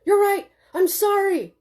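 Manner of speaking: loud, high, overexcited apology; can't stop shouting from excitement; excited shouting. A narrator's reading. Husband shouting an excited apology to his wife. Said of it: "Jim couldn't quiet down. He was too excited".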